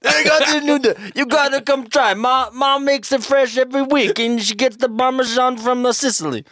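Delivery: put-on high-pitched Italian-American accent, boasting; mock Italian-American. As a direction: sell it like a proud Italian-American cook. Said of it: "we can do it Italian".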